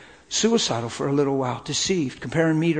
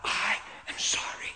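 soft whispered apology through tears; wife whispering sorry; 11 dB quieter than usual. Retold or imitated. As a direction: barely voice it, choked up. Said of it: "she said".

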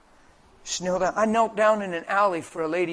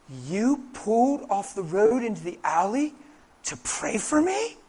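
slow, incredulous question climbing high at the end; imitated disbelief; 4 semitones higher, slower than usual. Woman asks preacher why he stopped to pray, incredulous. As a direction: act out her amazement, stretching the final words upward.

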